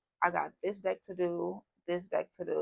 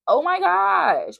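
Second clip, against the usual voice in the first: high, slow, stretched-out gush; excited gasp; 12 semitones higher, 10 dB louder, much slower than usual. Real, not acted. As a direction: gush it out, thrilled. Excited gush over love reading.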